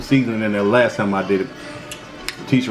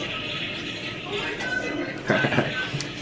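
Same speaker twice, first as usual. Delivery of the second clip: high giggle; 8 dB quieter than usual. Real, not acted. Laughs after joking to viewers.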